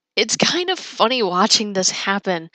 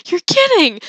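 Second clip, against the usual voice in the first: disbelieving; 10 semitones higher, 6 dB louder than usual. Retold or imitated. Disbelief, laughing between the words.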